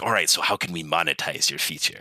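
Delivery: lightly voiced goblin; a hint of a goblin voice. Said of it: "goblin voice".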